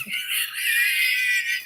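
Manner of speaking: high shrieking laugh at her own joke. Real, not acted. Laughing at her joke with God, a high shrieking laugh. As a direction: squeal with laughter.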